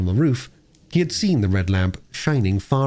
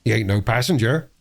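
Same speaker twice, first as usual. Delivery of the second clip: workman voice. Acted.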